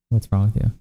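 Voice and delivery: ordinary delivery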